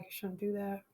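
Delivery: talking with food in mouth; words round a bite of food; mouth full. Real, not acted. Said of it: "you've got your food in your mouth".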